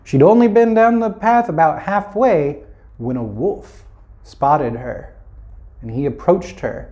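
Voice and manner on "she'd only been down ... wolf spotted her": ordinary delivery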